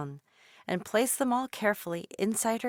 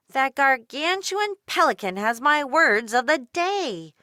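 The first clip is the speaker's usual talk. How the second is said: put-on louder, higher horrified girl; horrified yell; 6 semitones higher, 8 dB louder than usual. A narrator's reading. Dolores yelling as the pelican flies off, louder. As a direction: cry out in alarm at the thief. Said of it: "yelled out to say".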